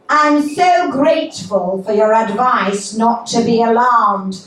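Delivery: high, emphatic mother reading out her text; indignant mother. Acted. Mother texts her daughter, hammed-up pointed indignation. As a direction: act out a mother's pointed reply.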